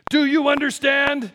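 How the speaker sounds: very loud, high mock-angry shout; stern daddy yell. Sons imitating daddy, loud scolding shout. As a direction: bellow it like a scolding dad. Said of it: "how does daddy go".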